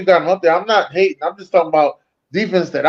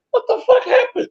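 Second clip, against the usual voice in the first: squeaky disbelieving cry, far higher, half laughing; retold shock. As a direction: act stunned watching the star go down. Retold shock watching injury.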